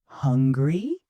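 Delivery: "Hungry" gliding up into a question; rising intonation. Shown as a demonstration. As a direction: lift the end of "Hungry" to ask. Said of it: "My voice goes up on hungry".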